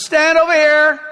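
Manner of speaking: commanding Elijah. Acted. Elijah ordering the crowd to stand by him, commanding. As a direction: call them over boldly. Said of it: "Elijah says".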